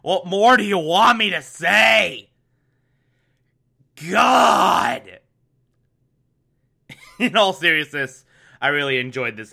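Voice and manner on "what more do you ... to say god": mock-fed-up yell; exasperated shout. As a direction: throw up your hands and shout. Exasperated outburst at audience.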